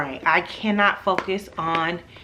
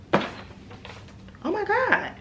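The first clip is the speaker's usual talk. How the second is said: high slow breathy oh my God; excited gasp; 12 semitones higher, 6 dB quieter, much slower than usual. Real, not acted. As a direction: gasp with delight. Excited opening package.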